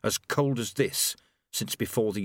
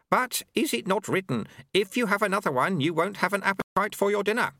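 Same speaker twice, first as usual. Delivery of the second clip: put-on higher-pitched old monk voice; Lu-Tze, sagely; 10 semitones higher than usual. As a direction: recite homely wisdom in a high voice. Lu-Tze quotes his sayings.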